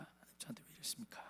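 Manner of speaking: 하나님 저한테 왜 이러십니까 breathed in an unvoiced whisper; whispered lament. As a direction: whisper the plea, almost to yourself.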